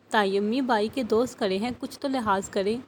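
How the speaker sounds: plain reading voice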